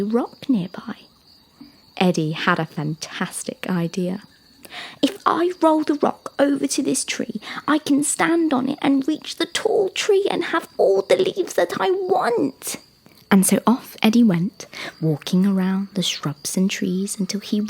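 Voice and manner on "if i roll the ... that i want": put-on high, rising child giraffe voice; eager young giraffe. Young giraffe hatching his plan, high and eager. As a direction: plan aloud, higher and more eager towards the end.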